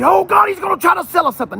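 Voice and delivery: high, fast mock panic; mock worried customer. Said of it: "so you don't have to run away".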